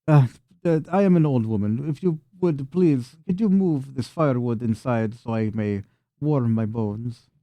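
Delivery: put-on lilting old-woman voice asking a favour; frail old woman, pleading. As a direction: play an old woman politely begging help. Game master voices Anna.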